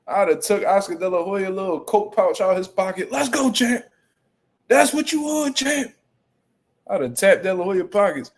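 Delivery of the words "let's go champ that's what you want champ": high-pitched taunting calls to the champ; Shannon Briggs heckling. Acted. Streamer pretending to harass De La Hoya at weigh-in, hyped heckling. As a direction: goad him in a raised, hyped voice. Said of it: "in that bitch talking crazy".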